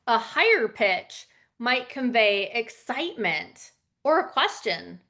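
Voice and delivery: normal talking voice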